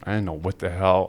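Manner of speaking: ordinary talk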